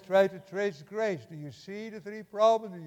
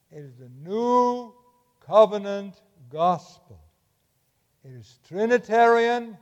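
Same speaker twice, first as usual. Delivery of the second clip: slow, loud, insistent preaching; emphatic proclamation; 3 semitones higher, 10 dB louder, slower than usual. Real, not acted. Preacher urging the congregation, slow and loud. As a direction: lean hard on each word, drawn out.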